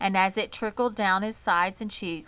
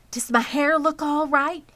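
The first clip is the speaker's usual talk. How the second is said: fussing wife; 8 semitones higher, 7 dB louder than usual. A narrator's reading. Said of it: "asked Miss Zuckerman".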